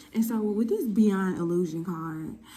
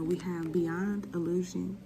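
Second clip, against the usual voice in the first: ordinary talk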